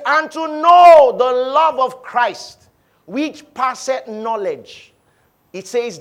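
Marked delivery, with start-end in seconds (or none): 0.0-4.9 slow, emphatic reading; solemn preached reading; scripture reading. Acted. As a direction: lift the verse up slowly. Reads scripture slowly.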